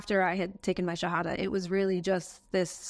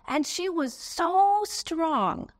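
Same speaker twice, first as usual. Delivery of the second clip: slow, high, voice catching with real emotion; real emotion welling up, high and very slow; getting emotional; 8 semitones higher, much slower than usual. Real, not acted. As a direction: drag the words out as tears rise. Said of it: "I'll get emotional".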